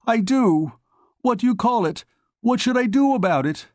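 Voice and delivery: rising fear, voice climbing high; in terror. A narrator's reading. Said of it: "said Gurman in terror".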